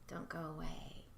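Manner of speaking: ordinary talk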